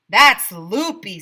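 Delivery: loud, slow outraged remark; teacher scoffing loudly; indignant. A narrator's reading. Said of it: "said Missus Roopy".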